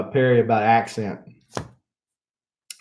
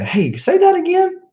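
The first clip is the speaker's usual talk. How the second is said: high-pitched, louder imitation of curious people; imitated Northern listeners; 4 dB louder than usual. People up north asking him to repeat, high imitated voice. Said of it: "it's funny when people would say".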